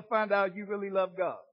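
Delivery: ordinary talk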